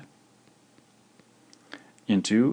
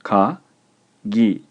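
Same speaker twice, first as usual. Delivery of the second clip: ka and gi said apart, with a pause; clear, emphatic dictation in separate syllables; 6 dB louder than usual. Shown as a demonstration. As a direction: dictate ka, then gi, each on its own. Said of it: "The word is two syllables".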